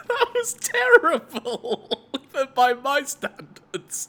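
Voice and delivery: laughing through the words; giggling at a bad take. Real, not acted. Laughing at own bad clip.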